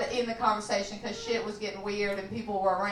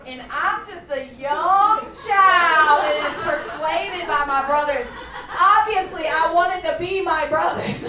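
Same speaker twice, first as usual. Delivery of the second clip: loud, slow, laughing confession; laughing through it; 4 semitones higher, 6 dB louder, slower than usual. Real, not acted. Telling the crowd, laughing loud and slow. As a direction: crack up while admitting it. Kid persuaded by brothers, laughing.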